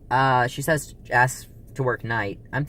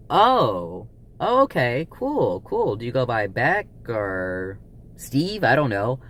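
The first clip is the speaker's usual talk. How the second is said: maybe-joking reaction; 2 semitones higher, 3 dB louder, much slower than usual. Retold or imitated. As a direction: imitate his possibly sarcastic acceptance, pitch leaping up. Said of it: "I can't tell if he's joking or not".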